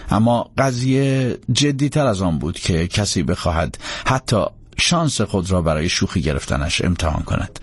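ordinary delivery

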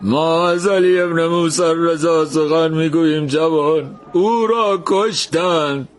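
high and slow, near tears; grieving man, drawn-out words; choked up. A narrator's reading. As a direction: break the news through a lump in the throat. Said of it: "با بغض گفت".

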